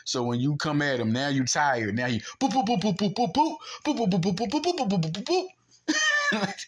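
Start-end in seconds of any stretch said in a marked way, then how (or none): 2.5-6.5 high-pitched rapid boops; playful high punch noises; imitated punch flurry. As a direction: mimic a volley of quick jabs.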